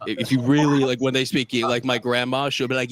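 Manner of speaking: ordinary talk, nothing marked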